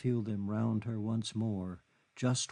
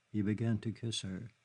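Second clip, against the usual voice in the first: usual speaking voice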